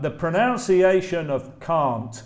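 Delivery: ordinary talk, nothing marked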